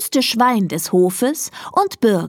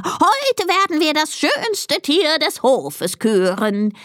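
high, drawn-out ceremonial crow; crowing rooster; 9 semitones higher, slower than usual. A narrator's reading. Rooster Konrad presents the first contestant, crowing slowly. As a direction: announce it as a slow, high rooster. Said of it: "krähte er".

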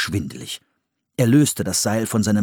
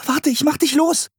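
calling out. Acted. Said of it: "rief Anne".

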